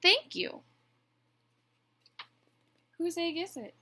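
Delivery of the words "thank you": very high, loud thanks; honking goose. A narrator's reading. Goose thanks the bluebird for the compliment, honking. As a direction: honk it out pointedly. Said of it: "honked Goose".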